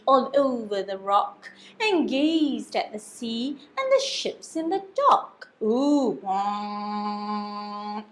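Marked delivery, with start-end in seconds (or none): none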